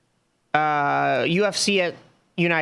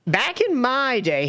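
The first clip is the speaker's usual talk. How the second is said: put-on slow, higher grumpy old man; back in my day rant; 6 semitones higher, slower than usual. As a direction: start a cranky old-timer rant. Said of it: "I'm gonna sound like old man yelling at cloud".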